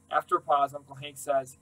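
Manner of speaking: ordinary delivery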